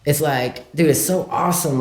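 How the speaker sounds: ordinary talk, nothing marked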